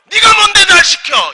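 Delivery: high, loud indignant outburst; God. Pretend. God scolding the servant for ordering him, indignant shout. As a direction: shout in mock offence at the nerve of it. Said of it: "하나님 불쾌하지 않으셨을까요?".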